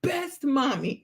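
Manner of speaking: put-on slow little-boy compliment; imitating son. Mom quotes son's compliment today, imitating him. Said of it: "today he told me".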